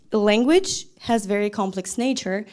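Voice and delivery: ordinary delivery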